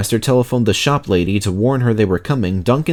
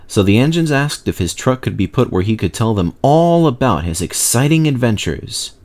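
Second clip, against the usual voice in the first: plain reading voice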